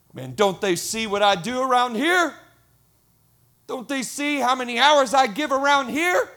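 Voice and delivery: loud, high, aggrieved complaint; acted indignation. Volunteer griping about unnoticed hours at church, mock indignation. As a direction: play a fed-up, unappreciated volunteer.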